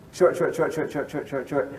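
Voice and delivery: rapid, evenly clipped run of SHORTs; staccato counting on SHORT; short beats. Shown as a demonstration. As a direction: rattle off each SHORT as one quick beat. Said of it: "a short syllable takes one beat".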